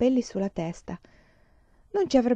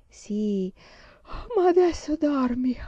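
sleepy, yawning dismissal; through a yawn; 5 semitones higher, slower than usual. A narrator's reading. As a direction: yawn the words out, stretched and high. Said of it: "disse mamma con uno sbadiglio".

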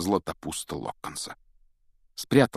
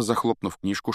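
ordinary delivery

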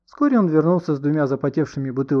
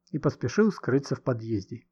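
ordinary delivery, nothing marked

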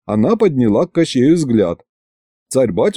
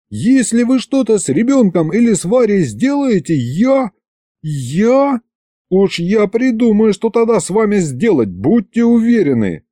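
high-pitched, worked-up threatening; yelled threat; 10 semitones higher than usual. A narrator's reading. Husband protecting wife and child from sorcerers, moderate yell. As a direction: threaten in a raised pitch, stammering on я. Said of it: "заорал уже и я".

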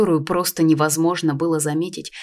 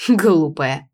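dismissive, with a snort; scornful scoff; 5 dB louder than usual. A narrator's reading. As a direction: scoff the word contemptuously. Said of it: "хмыкнул Луэй".

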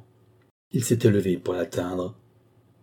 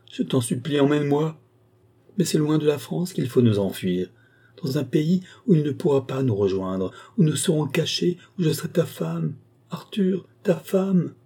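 high, pleading voice climbing higher; increasingly passionate; 7 semitones higher than usual. A narrator's reading. Woman begging Arthur to flee abroad, increasingly passionate. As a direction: implore with mounting fervour. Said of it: "chaque fois avec un accent plus passionné".

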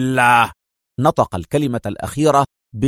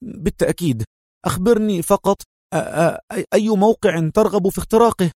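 higher, stammering eagerness; nervous young hacker; 7 semitones higher than usual. Acted. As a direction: stumble over the words, anxious to please. Sherif voiced, stammering. (شاب متوتر متلعثم)

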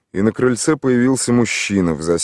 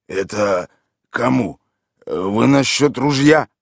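put-on confused drunk; 4 semitones higher, 4 dB louder, slower than usual. A narrator's reading. Drunk Mikhail voiced.

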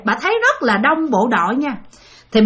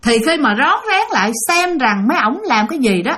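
usual speaking voice

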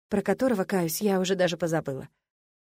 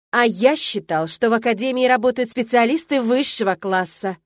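louder, stern rector's rebuke; louder, pointed reproach; raised voice; 3 semitones higher, 7 dB louder than usual. A narrator's reading. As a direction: raise the tone to scold. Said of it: "Повысив тон".

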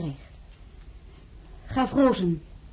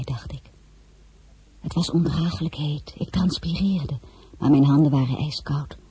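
normal talking voice

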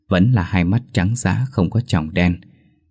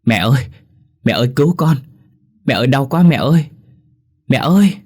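a rising, much higher and louder cry of pain, faster in the middle; screaming for mother; 7 semitones higher, 4 dB louder, faster than usual. Acted. Possessed girl being whipped cries out to her mother, a high, loud, panicked scream. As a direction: scream for mama as the blows land. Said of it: "Trang la lớn".

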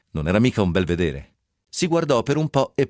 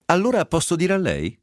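put-on fresh young clerk voice; 8 semitones higher than usual. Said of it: "chiese la voce fresca".

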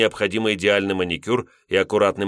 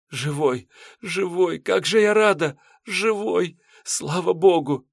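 high, tearful voice, sobbing with joy; happy crying; 10 semitones higher than usual. A narrator's reading. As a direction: weep with relief and joy. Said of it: "Вера плакала, кричала от радости".

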